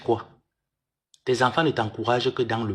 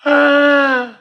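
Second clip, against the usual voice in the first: mock wail; 13 semitones higher, 8 dB louder than usual. Mock lament over two stars.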